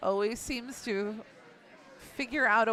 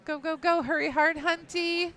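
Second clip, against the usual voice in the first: high urgent sweep call; curling skip yelling; 7 semitones higher than usual. Real, not acted. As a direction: yell at the sweepers to brush.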